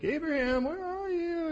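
mock searching call. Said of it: "It isn't like God's like".

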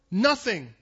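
ordinary talk

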